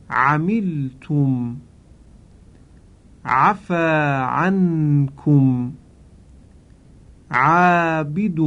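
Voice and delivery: each word slow and drawn out, the ain in نعلم and عیون pressed from the middle of the throat; correct Arabic ain. Shown as a demonstration. Teacher showing listeners at home the correct ain, slow careful demonstration. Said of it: "به تلفظ صحیح حرف عین توجه کنید".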